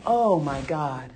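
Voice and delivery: normal talking voice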